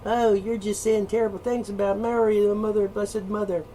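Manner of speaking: high, prissy mocking voice; mock pious whine. Mocking a pious listener defending Mary, high prissy whine. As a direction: parody a shocked believer.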